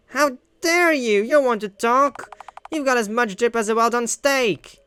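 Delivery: roast him right back; high haughty burn; offended comeback. Acted. Character fires back insult.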